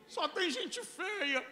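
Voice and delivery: high, slow, outraged emphasis; indignant shout. Retold or imitated. Husband repeating the insult to his wife, high indignant outcry. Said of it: "no meio da minha indignação".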